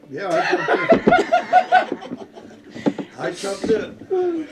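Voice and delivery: laughing through the words. Real, not acted. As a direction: breaks into laughter as he answers. Laughing while recalling the jump.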